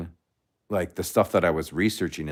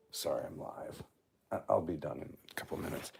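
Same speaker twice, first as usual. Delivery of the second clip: hushed aside; 15 dB quieter than usual. Real, not acted. Aside to someone in the room.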